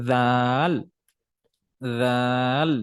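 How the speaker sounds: "রাল" as long "dhaal" with voiced th; even recited "রাল", said dhaal; tongue-out dhal. Shown as a demonstration. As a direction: start "রাল" tongue between teeth, hold the aa. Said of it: "যেমন দেখেন".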